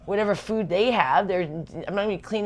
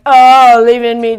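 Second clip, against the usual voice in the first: mocking loud quote; 5 semitones higher, 17 dB louder, slower than usual.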